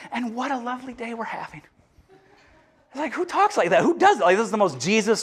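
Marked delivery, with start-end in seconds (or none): 0.1-1.6 mock cheerful aside. Jesus impression turns light.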